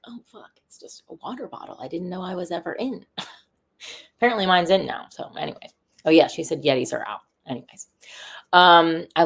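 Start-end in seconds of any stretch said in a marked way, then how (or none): 0.1-3.0 hushed mock dismay, under the breath; whispered aside. Telling how she reacted to her niece, whispered. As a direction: let it slip out in a whisper. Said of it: "I was like".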